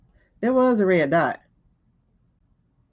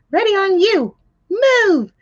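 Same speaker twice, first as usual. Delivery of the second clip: high, loud barked order; imitating the agent yelling "Move!"; mock agent command; 10 semitones higher, 5 dB louder, slower than usual. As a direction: shout it like a security agent.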